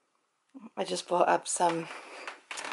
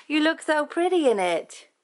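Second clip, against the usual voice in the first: high, loud cooing at the dog; a sweet pet voice for her dog; cooing pet talk; 9 semitones higher, 5 dB louder than usual. Real, not acted. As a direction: gush over the puppy in a sing-song.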